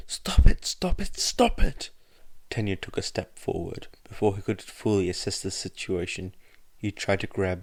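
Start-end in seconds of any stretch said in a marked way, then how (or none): 0.1-1.9 high, loud cries repeated; repeated anguished shouting. A narrator's reading. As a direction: scream it again and again. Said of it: "he shouted".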